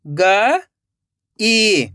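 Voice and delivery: गई split into ग, long pause, ई; rising ग, then falling ई; syllable by syllable. Shown as a demonstration. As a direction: sound out गई in two separate parts. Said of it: "एक एक अक्षर का उच्चारण करना है".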